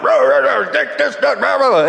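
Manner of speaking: dad barking orders in the salvage yard; retold yelling. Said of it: "my dad was yelling".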